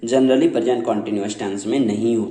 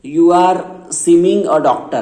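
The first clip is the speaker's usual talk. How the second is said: slow, higher and louder; mock wrong example; 5 semitones higher, 6 dB louder, much slower than usual. Shown as a demonstration. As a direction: show the mistake with a raised voice. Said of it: "ऐसे नहीं".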